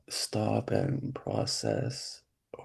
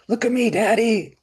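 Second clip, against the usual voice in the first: imitating a little boy; 11 semitones higher, 4 dB louder than usual. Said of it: "I can remember my son".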